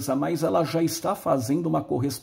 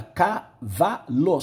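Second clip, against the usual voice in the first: slow sounding out; much slower than usual. Shown as a demonstration. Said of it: "só três letras".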